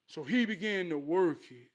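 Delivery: normal talking voice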